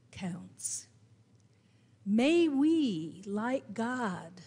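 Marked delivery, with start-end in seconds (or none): 0.1-0.9 a soft whispered conclusion to the point. Real, not acted. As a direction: breathe the word counts as a whisper. Sermon's quiet climax.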